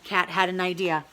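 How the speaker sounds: usual speaking voice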